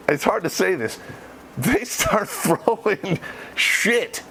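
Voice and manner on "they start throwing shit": slowly, breaking into laughter mid-line; laughing. Real, not acted. Veteran laughing at the monkey attack, in slow laughing speech. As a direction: giggle through the words. Laughs while telling the story.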